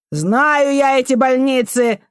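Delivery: high, slow, angry squeal; shrieked retort. A narrator's reading. Janitor woman dismissing the boy's mother, shrieking. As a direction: squeal it with spite. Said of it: "взвизгнула дворничиха".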